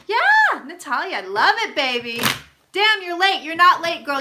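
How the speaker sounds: high, loud, delighted hello; excited greeting. Real, not acted. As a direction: greet a friend with big joy. Greeting a viewer who joined.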